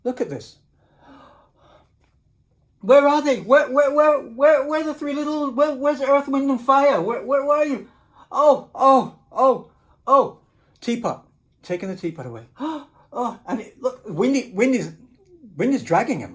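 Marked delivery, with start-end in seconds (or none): 2.8-10.4 higher, louder frantic calling; searching pig. Acted. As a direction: call out looking for them.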